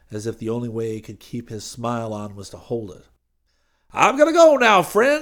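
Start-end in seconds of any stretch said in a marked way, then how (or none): 3.9-5.2 quick, loud, high preacher voice; hurried preacher. Acted. Preacher hurrying off after the car, loud and quick. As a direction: rush the words out, loud. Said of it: "he said quickly".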